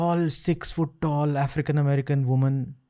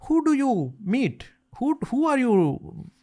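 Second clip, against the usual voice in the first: Indian father voice; 10 semitones higher, slower than usual. Retold or imitated. Dad asks in Indian accent.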